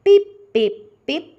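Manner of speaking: high loud squeaky pip pip pip; the mouse squeaks out loud. Acted.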